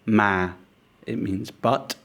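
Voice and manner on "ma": "ma" sliding gently downward; falling tone. Shown as a demonstration. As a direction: let the pitch of "ma" fall low. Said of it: "As a falling tone".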